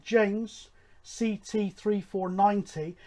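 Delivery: normal talking voice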